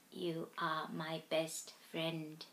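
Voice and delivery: soft and slow. A narrator's reading. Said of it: "he mouthed".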